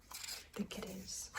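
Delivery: hushed suspense; whisper. Real, not acted.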